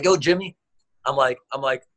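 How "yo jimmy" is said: calling out. Retold or imitated. Coach hailing Jimmy in the pool, raised call. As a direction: call his name a bit higher and louder.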